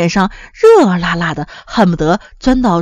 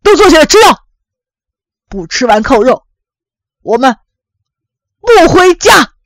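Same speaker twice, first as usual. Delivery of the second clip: high, forceful shouted orders; loud roar; 4 semitones higher, 5 dB louder than usual. A narrator's reading. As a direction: bellow at the family, dropping briefly before a final yell. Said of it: "大声吼". (朝兄弟姐妹大声吼叫)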